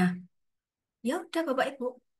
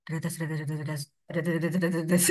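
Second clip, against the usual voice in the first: low, slow mock blubbering noise; imitated sobbing; 6 semitones lower, much slower than usual. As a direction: blubber it comically like a crying child.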